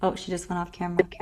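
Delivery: ordinary talk, nothing marked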